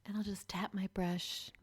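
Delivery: slow imitated ASMR whisper. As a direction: whisper it like a makeup ASMR video. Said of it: "'cause she'll be like".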